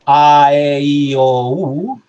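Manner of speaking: "a, e, i, o, u" sounded out one by one, from open to closed; teacher sounding the vowels from most open to most closed; vowel demo. Shown as a demonstration. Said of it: "si pensáis".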